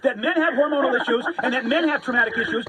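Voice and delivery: worked up, voice pitched high; real agitated yelling. Real, not acted. Man scolding a woman for laughing, agitated and high-pitched. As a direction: protest indignantly, pitch climbing. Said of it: "you're screaming about, you're screaming".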